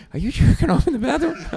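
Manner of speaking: high-pitched comic disbelief; suspicious wife. Retold or imitated. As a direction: voice an appalled wife on the phone. Voices his wife's suspicious question.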